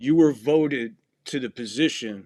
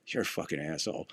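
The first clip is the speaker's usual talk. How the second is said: low, soft acted insult; hushed, lowered jab, retold; private exchange; 8 semitones lower, 8 dB quieter than usual. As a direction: slip the insult in under your voice. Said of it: "The only two people could hear it".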